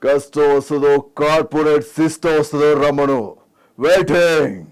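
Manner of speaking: projected veteran film-hero voice; Chiranjeevi. Acted. Host asks for Chiranjeevi, projected hero impression. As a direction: declaim it as a star's challenge. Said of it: "Chiranjeevi గారు".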